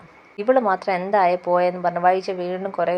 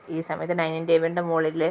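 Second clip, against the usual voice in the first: usual speaking voice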